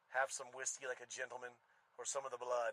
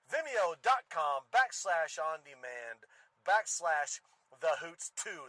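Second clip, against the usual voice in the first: ringmaster; 3 semitones higher, 10 dB louder, slower than usual. Pretend. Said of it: "Ladies and gentlemen".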